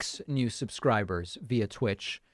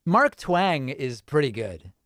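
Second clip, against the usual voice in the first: ordinary delivery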